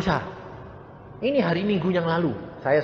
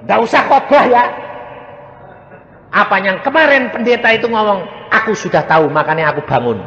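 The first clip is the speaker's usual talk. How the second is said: loud retort; 8 semitones higher, 9 dB louder than usual. Retold or imitated. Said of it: "mamai bilang apa".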